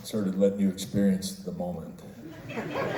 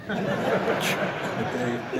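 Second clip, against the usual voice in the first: laughing; 10 semitones higher than usual. Real, not acted. Laughs at own lovers joke.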